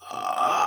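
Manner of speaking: short harsh scream, breathy; distorted scream demo. Shown as a demonstration. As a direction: show the changed scream sound.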